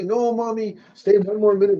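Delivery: put-on high, pleading kid's voice; pleading child. Mom imitating her crying child begging her to stay, high and pleading. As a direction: beg to stay a little longer.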